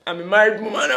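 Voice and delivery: laughing disclaimer. Real, not acted. Joking guilt over her crush.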